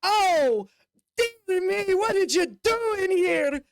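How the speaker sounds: high, shocked little gasps; fussy halfling. Acted. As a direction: squeak it out, scandalised and high. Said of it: "tiptoe up on it and goes".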